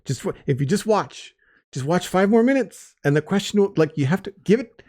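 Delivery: high-pitched plea, building in urgency; exasperated parental pleading. Retold or imitated. Host voices dad pleading with questioning son, exasperated. Retells a father pleading for patience.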